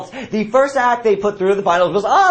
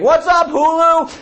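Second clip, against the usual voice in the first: mock stage yell; 9 semitones higher, 3 dB louder, slower than usual.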